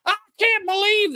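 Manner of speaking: very high, squeaky mock Israeli accent. Host mocking Israeli officials for viewers, in a squeaky mock Israeli accent. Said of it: "official Israeli accent".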